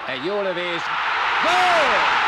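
rising into roaring goal call; goal shout. Real, not acted. Goal scored.

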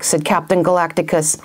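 plain reading voice

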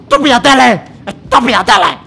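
very loud, high outraged shout; angry yelling. Real, not acted. Player cursing the TV that scared them, yelling. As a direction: yell it at full volume in shock.

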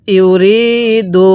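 ইউরিদু intoned very slowly with a long held vowel; teacher demonstrating ইউরিদু in recitation style; slow tajweed recitation. Shown as a demonstration. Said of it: "তাহলে কিভাবে পড়ব?".

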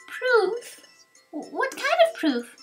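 high, questioning youngster voice; puzzled young hero. Acted. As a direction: ask high and eager, puzzled.

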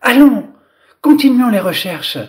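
high, loud rallying call; spirited storybook shout; crying out. A narrator's reading. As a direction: call out to urge the others on. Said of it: "la princesse qui cria aux fées".

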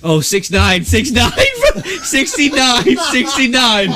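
high, laughing through the numbers; laughing guess. Retold or imitated. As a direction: blurt it out while cracking up. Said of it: "And I go".

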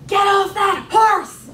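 a loud, high, slow yell. Retold or imitated. Mother yelling at her daughter from the grandstand, a loud slow yell. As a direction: shout it down from the grandstand. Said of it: "she yells".

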